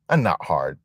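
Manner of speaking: ordinary delivery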